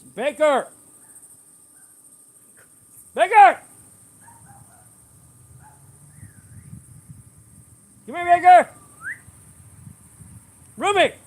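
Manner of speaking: loud high shouts across the yard; repeated yells for the dogs; calling the dogs. Real, not acted. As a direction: holler the names so they come running.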